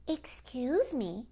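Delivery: slow, bright and polite; a kindly old woman brightly interrupting. A narrator's reading. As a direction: chirp a sweet, slow excuse me. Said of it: "she said brightly".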